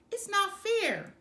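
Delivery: loud high protest from the cribs; yelled. A narrator's reading. Children yelling at parents from cribs, loud protest. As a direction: yell it, outraged kids. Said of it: "they yelled from their cribs".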